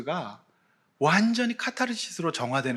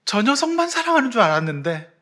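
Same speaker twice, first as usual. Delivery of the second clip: higher, louder, overcome with emotion; brothers' realization; 10 semitones higher, 6 dB louder than usual. Acted. Preacher voicing Jacob's sons realizing love, higher and moved.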